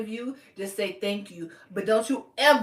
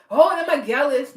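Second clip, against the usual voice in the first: high, loud mock boast of a Jamaican man; imitating a cocky man bragging about his women; 5 semitones higher, 7 dB louder than usual. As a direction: ham up a proud bragging Jamaican guy. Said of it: "they be like".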